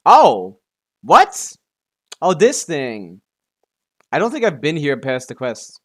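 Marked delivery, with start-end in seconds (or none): none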